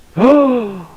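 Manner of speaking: high, long scandalised gasp; mock shocked gasp. Acting an American hearing a very rude word, mock shocked gasp. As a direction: gasp as if appalled by the insult.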